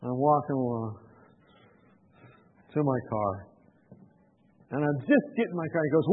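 ordinary talk

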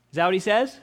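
ordinary talk, nothing marked

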